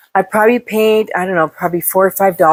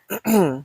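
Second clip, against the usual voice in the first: throat clearing; 6 dB quieter than usual. Real, not acted. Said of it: "dust in my throat".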